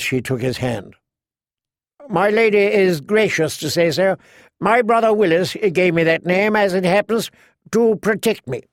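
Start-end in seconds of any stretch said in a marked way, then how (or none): 2.1-8.6 higher-pitched courtly knight; lifted, chivalrous character voice; gallant knight, gracious. Acted. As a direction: play a courteous young knight at a dance. Garland speaks as a gallant knight.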